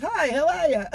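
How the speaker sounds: high, loud put-on hello; mock bright greeting. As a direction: toss out an exaggerated gushing greeting. Acting out the hurried greeting.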